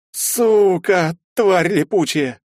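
high, loud gurgled curse, slow at the end; gurgling. Acted. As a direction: gurgle the curse through water. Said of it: "пробулькал я".

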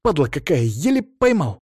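mildly annoyed grumble; grumbling. A narrator's reading. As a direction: curse the thing you caught. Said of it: "проворчал он".

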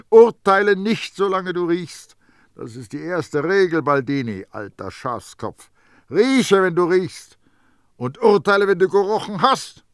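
high, loud, emphatic commands; stern self-admonishment. A narrator's reading. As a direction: hammer the rule into your own head. Baldini lectures himself on his rule.

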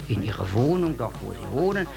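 ordinary talk

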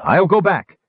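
high, loud gnome cry. A narrator's reading. Unseen gnome warning Sturm on the rope, high loud cry. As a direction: yell it down from the airship, high and loud. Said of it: "cried the unseen gnome".

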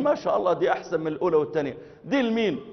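high, quick Egyptian awe; astonished Egyptian man; amazed Egyptian. Retold or imitated. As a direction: gush over the tower in Egyptian. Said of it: "أخ مصري".

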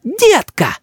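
high indignant call; child's yell. A narrator's reading. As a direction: call grandpa out in a cross boy's voice. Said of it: "закричал Рис".

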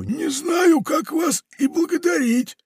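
high, shouted gushing thanks; gushing pirate. Acted. Pirate lays on thanks to Funtik, high and shouting. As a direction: overdo the gratitude.

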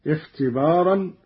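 usual speaking voice

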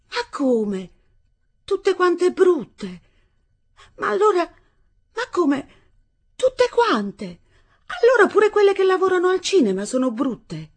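put-on high, loud, indignant girlish disbelief; incredulous protest. Young bride argues with her husband, high and loud in disbelief. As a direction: hammer the disbelief home.

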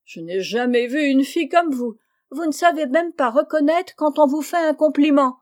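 reproche de grande sœur qui sait tout, voix haute; air de tout savoir. A narrator's reading. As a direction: faire la leçon haut et fort, en experte. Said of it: "de l'air d'une personne qui croit connaître très bien toutes ces choses-là". (ton entendu et supérieur, voix haute)

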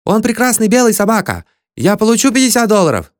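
high-pitched, exultant boasting; jubilant. A narrator's reading. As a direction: exult over the catch in a very high voice. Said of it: "ликовал Кули". (восторженно, тонким голосом)

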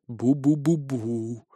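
slow, quiet ghostly "bu-bu-bu-bu"; soft wavering moan from the dark; eerie distant booing. A narrator's reading. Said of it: "раздалось из темноты". (протяжное тихое бубнение из темноты)

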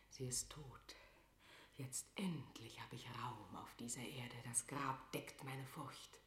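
a queen whispering her dread to herself; hushed, fearful inner monologue; whispering alone, afraid to hear the news; anxious whisper. Acted.

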